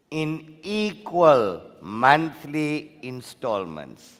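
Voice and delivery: slow, deliberate dictation; dictating. Real, not acted. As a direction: read it out for notes. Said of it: "take all this information down".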